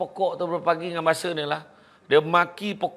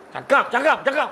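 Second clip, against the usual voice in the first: eager urging; 9 semitones higher, 5 dB louder than usual. Acted. Healed Farah eager to shop.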